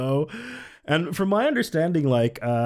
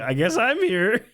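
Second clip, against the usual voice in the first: mock resigned; 8 semitones higher than usual. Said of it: "he's like".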